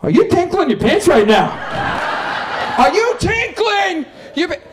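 hammed-up dad playing tough guy; prudish dad as gangster. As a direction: bark a gangster threat with clean words. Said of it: "it would be like".

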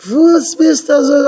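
high, drawn-out mock scolding; retold scolding wife. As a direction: imitate a wife's indignant yell, stretched out. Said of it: "אין דער ווייב האט מיך אנגעשריגן".

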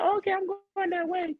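high-pitched mock voice of the driver; imitated cart driver.

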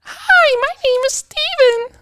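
squeaky high-pitched little voice. Shown as a demonstration. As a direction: push the voice way up high. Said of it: "can we go opposite".

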